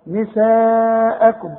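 نساءكم with the aa held very long and slow; obligatory madd. Shown as a demonstration. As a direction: stretch نساءكم for four counts. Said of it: "شوف المدود،". (مد نساءكم أربع حركات)

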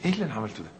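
soft, subdued husband talking to himself; calmed-down regret. Acted. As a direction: drop to a quiet, acted second thought. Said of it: "لما تقعد كده وتهدى تقول".

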